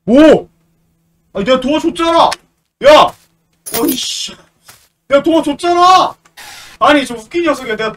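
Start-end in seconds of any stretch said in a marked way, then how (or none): 1.3-3.1 louder, higher outraged protest; indignant yell. Real, not acted. Angry protest at the game. 5.1-6.2 louder, higher outraged protest; indignant yell. Real, not acted. Angry protest repeated.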